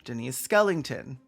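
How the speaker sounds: high, drawn-out proclaiming of names; mock roll-call announcement. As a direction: announce each name grandly, with a pause after. Reads out list of joke names.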